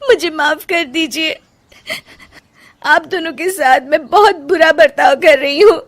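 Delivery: wife apologizing to husband and mother-in-law; tearful apology. Acted. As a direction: beg forgiveness through tears.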